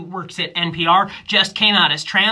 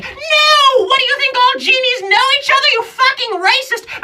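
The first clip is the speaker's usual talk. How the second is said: very high, loud genie outrage; offended genie yell; 6 dB louder than usual. Acted. Genie yelling at the comic about Aladdin, very high and loud. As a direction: shriek it as an insulted genie. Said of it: "She's like".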